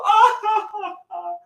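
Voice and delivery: high mock sobbing; mock crying. Boy crying after bullies mock him, mock sobs. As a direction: fake a wailing cry. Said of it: "then I start to cry".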